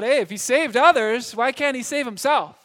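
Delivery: loud, high taunt; mocking shout. Passer-by jeering at the cross, loud mocking shout. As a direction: jeer it across the crowd. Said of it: "someone shouts out".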